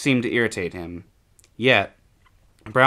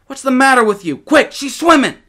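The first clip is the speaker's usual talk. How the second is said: put-on high, loud, urgent boy's cry; panicked shout; 10 dB louder than usual. A narrator's reading. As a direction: yell it with a bear coming. Boys flee a swimming bear.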